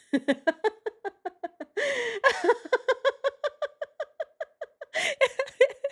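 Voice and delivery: long helpless high-pitched laughing fit; burst of laughter. Real, not acted. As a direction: lose it completely, laughing high. Cracks up at the story.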